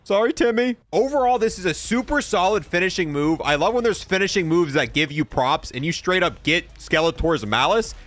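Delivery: usual speaking voice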